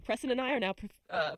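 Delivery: normal talking voice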